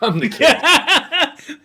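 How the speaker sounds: put-on high, slow, laughing "I'm the kid."; teasing kid voice. Mocking the younger cohost, a high laughing kid voice. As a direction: squeak it out like a cheeky youngster while laughing. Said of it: "He's like".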